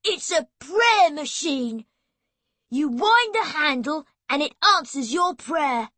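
loud, high, rude retort; rude outburst. Acted. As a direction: fling it at her to annoy her. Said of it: "he said rudely".

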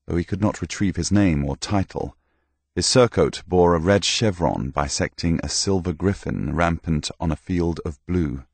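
plain reading voice

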